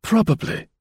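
high, dry single word; a dry, sceptical probably; dry reply. A narrator's reading. Chauvelin answering Gourdon, dry and high. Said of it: "rejoined the other dryly".

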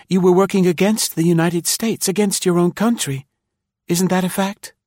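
high-pitched, decisive woman's voice; determined. A narrator's reading. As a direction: press him firmly for the truth, pitched high. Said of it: "she spoke with determination".

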